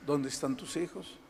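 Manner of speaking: normal talking voice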